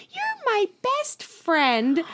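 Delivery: high, drawn-out cooing imitation; imitated mom's high pet voice; mom doting on cat. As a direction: coo it slowly to a beloved pet. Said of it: "and she went".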